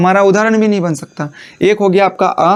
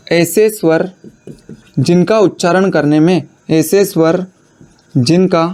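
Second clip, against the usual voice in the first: dictation. Acted. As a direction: read it out so students can write. Dictating a definition for notes. (रुक-रुक कर notes लिखवाना)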